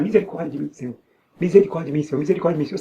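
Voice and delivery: ordinary talk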